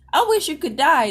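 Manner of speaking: put-on high, loud angry kid; kid brother's outburst. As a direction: yell it like a furious little brother. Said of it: "that's him".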